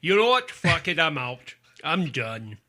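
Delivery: loud mock-outraged actor quitting in a put-on voice; Sean Connery. Co-host voices the actor quitting over a bad movie, Sean Connery impression. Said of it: "He's like".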